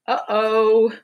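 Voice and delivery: a cartoonish oops for the kids; playful uh-oh. Refrain voiced by book character.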